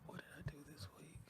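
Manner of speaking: fast, hushed whisper to himself; rushed secret whisper. Acted. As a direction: whisper it quickly under the breath. Mock whisper imitating co-host.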